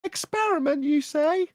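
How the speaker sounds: "Experiment" lifted high, mock incredulous; upward inflection. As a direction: pounce on "Experiment" with a sky-high questioning lift. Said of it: "the reply from the flat earther".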